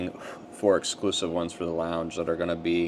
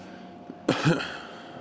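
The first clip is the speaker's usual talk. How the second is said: short dry cough; 9 semitones higher than usual. Real, not acted. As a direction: cough once, unvoiced. Coughs while smoking.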